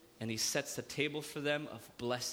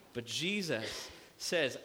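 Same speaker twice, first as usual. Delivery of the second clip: normal talking voice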